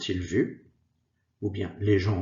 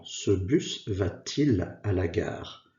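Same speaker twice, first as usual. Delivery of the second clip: slow careful demo; slower than usual. Shown as a demonstration.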